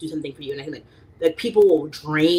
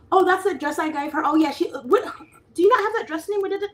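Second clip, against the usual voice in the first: mocking a relative; 13 semitones higher, faster than usual. Mocking how family asks about gifts.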